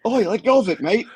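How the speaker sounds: high-pitched, inexpert Aussie impersonation; attempted Australian accent. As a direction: have a rough go at his Australian accent. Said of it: "I can't do his accent".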